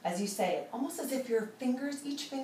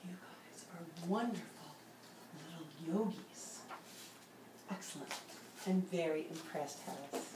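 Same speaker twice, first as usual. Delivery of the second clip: hushed, gentle praise; soft whispered praise; 4 semitones lower, 11 dB quieter than usual. Real, not acted. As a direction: keep the calm while praising. Soft praise after whisper round.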